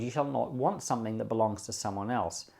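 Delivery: usual speaking voice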